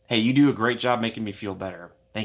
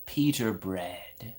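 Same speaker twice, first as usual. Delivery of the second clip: silly storybook voice; 3 dB quieter than usual. Acted.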